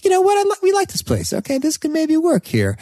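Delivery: high, thrilled and eager; excited. Retold or imitated. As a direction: squeal with delight about the house. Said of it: "It's like".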